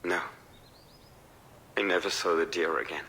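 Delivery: ordinary talk, nothing marked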